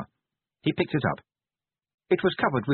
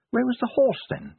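puzzled; 12 semitones higher than usual. A narrator's reading. Puzzled dwarfs wonder.

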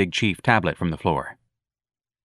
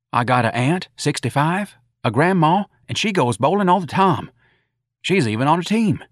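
high-pitched, folksy and keen; eager, friendly patrolman; 9 semitones higher, 3 dB louder than usual. Acted. Patrolman coaxing Mrs. Riley to bowl, eager. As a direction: talk up bowling in a higher voice.

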